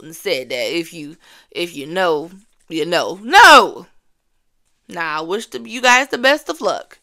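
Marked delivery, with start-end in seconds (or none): 3.2-3.9 loud, high outburst; indignant shouted no; sharp no. Real, not acted. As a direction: blurt it out in protest. Protesting [name]'s answer.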